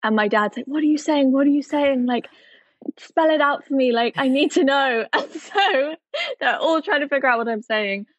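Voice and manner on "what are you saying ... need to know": higher-pitched mock urgency; baffled dad. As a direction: lightly imitate a dad desperate to understand. Acts out baffled dad pressing her.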